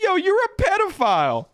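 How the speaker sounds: high, loud mock trash-talker yelp; mock gamer accusation. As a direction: squeak it out like a lobby kid. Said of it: "some guy's like".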